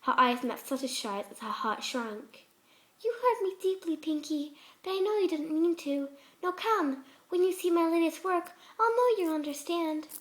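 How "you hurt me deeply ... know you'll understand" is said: higher, gently forgiving yet insistent; hurt, coaxing Fluttershy. A narrator's reading. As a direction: forgive her sweetly, then lead on.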